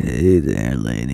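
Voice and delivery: put-on very low, soft country accent. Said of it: "I just had to put in a country accent".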